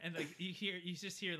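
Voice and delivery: ordinary talk, nothing marked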